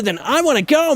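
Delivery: high, pushed heroic anime shout; Goku voice. Shown as a demonstration. Goat villager accidentally sounding like Goku. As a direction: rise on go like a Saiyan hero. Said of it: "turned into Goku there".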